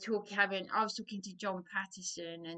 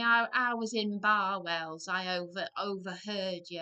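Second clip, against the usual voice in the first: northern woman; 8 dB louder than usual. Retold or imitated. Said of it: "she said to me".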